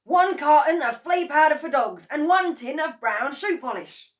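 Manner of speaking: high, loud and eager; excited announcing. A narrator's reading. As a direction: proclaim each item like a prize. Mr Kranky announcing his purchases.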